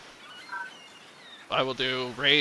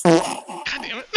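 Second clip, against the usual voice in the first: choking; 3 semitones higher, 8 dB louder, much slower than usual. Real, not acted. Chokes on tacos, coughing.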